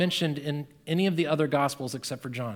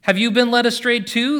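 higher, louder mocking question; 8 semitones higher, 8 dB louder than usual. A narrator's reading. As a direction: sneer at the guards. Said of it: "the Pharisees mocked".

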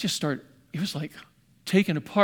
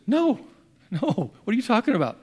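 shocked denial; 6 semitones higher than usual. Retold or imitated. Shocked reply to "I hate God.

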